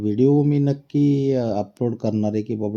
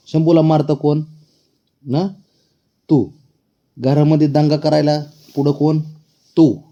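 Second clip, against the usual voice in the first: mock accusing; 5 semitones higher, 6 dB louder than usual. Real, not acted. Mock accusation game with the cat.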